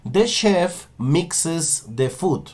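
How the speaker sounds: slow, careful English with clear mixes; slow model sentence. Shown as a demonstration. Teacher slowly models mixes for the students. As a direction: lay each word out slowly. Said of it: "Entonces si yo digo".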